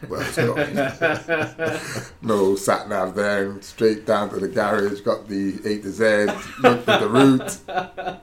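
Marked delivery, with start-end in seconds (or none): 0.1-1.8 slow and higher, laughing through it. Real, not acted. Laughing to the hosts about getting lost on the way. 6.6-8.2 much higher, slow and louder, laughing. Real, not acted. Laughing to the hosts about getting lost on the way.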